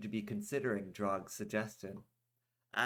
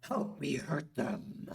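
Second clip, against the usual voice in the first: begging; 5 semitones higher, slower than usual. A narrator's reading. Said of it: "Drog begged the merchant".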